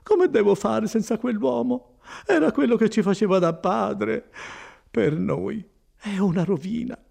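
high, tearful lament; despairing woman near tears; almost crying. Acted. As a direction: wail it as if bereft. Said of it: "quasi piangendo".

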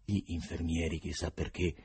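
ordinary delivery, nothing marked

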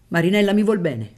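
loud, high-pitched exclamation; exclaiming. A narrator's reading. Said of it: "esclamò il barone".

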